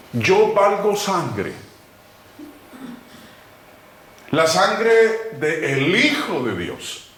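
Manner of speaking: very slow, weighty sermon phrases; solemn preaching. Acted. Pastor testifying to his salvation, slow and solemn. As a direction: lay each word down with reverence.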